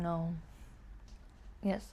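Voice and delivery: normal talking voice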